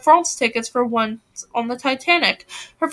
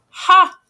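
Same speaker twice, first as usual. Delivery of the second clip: scoffing ha; 7 semitones higher, 6 dB louder than usual. A narrator's reading. Jane scoffs at her sister.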